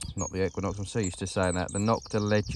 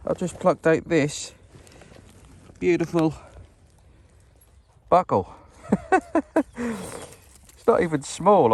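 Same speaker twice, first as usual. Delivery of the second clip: thrilled; 6 semitones higher, 4 dB louder, slower than usual. Real, not acted. Shows freshly found buckle.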